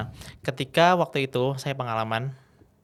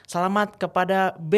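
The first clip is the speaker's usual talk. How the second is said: raised-pitch announcer call, name stretched out; MC winner announcement; 7 semitones higher, 3 dB louder than usual. Retold or imitated. Co-MC names a winner to the audience, announcer call. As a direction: proclaim the winner to the hall.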